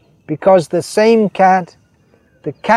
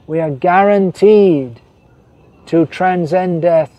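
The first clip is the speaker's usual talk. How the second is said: normal talking voice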